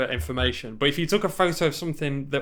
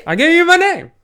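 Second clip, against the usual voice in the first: very high, loud mock outrage; exasperated protest; 10 dB louder than usual. Player pleading with distrustful Saeed, loud mock exasperation. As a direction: squeal the protest in disbelief.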